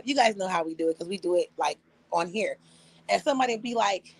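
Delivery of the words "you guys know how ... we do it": ordinary delivery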